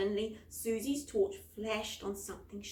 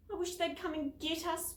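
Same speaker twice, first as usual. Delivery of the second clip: whimpered; 7 semitones higher than usual. A narrator's reading. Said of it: "whimpered Penny".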